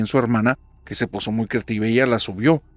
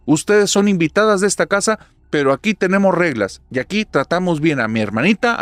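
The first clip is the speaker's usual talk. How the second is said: loud, high and stern, scolding the dolls; speaking very loudly; 7 semitones higher, 6 dB louder than usual. Acted. Big sister scolds the dolls to protect her sister, loud and stern. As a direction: lay down the law to the dolls at full voice. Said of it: "Sara les habla bien fuerte".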